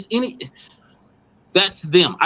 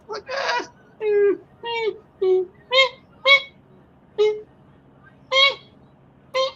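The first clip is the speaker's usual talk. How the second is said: mocking stammer; slower than usual. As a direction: sputter like you can't get words out. Mocking Shaka's speech.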